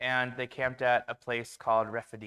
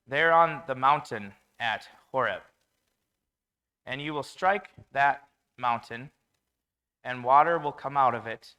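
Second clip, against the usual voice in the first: commanding God voice; 7 dB louder than usual. Retold or imitated.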